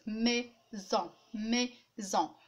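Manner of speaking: "Maison" split as mai, pause, son; two separate sounds. Shown as a demonstration. Teacher showing the two things heard, syllables apart. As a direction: make both halves of "maison" heard separately. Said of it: "كنسمع deux choses".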